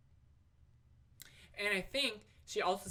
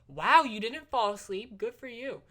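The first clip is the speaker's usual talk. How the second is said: slow, louder teasing wow; mock impressed; 6 semitones higher, 8 dB louder than usual. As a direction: tease him with fake praise. Mock praise to a friend.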